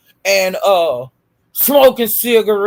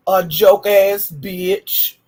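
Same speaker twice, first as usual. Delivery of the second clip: usual speaking voice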